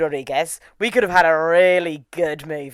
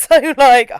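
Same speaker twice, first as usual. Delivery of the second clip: normal talking voice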